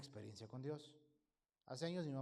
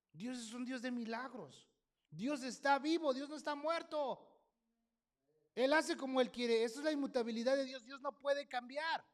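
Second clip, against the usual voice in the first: loud, higher, emphatic proclamation; preaching at full force; 7 semitones higher, 6 dB louder than usual. Acted. Preacher declaring God alive against doubters, emphatic. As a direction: declare it with fire.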